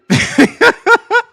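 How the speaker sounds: high, loud burst of laughing; laughter. Real, not acted. Streamer cracking up at being brigaded online, high loud laughter. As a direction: crack up loudly, pitched high.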